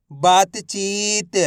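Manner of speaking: बातचीत very slow, with long ची; syllables blended slowly. Shown as a demonstration. Teacher reading for students to repeat, बातचीत drawn out. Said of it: "उच्चारण करके इनको पढ़ रहा हूँ".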